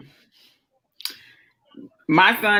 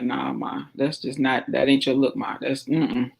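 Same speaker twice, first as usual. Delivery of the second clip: low, soft son shaking his head at mom; quietly and firmly say no; son shutting it down; 6 semitones lower, 7 dB quieter than usual. Retold or imitated. Son telling mom her slang is not her look, low and soft. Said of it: "He just like".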